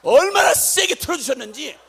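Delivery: loud, high amazed cry; amazed exclaim. Real, not acted. Preacher telling the congregation about the cold, loud amazement. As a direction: marvel out loud at how strong it was. Marveling at strong cold wind.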